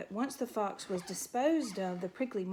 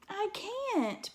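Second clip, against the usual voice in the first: pitched much higher, frightened plea; a small mouse's desperate cry; 12 semitones higher than usual. A narrator's reading. Frightened mouse refusing to leave the log. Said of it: "Poppy cried".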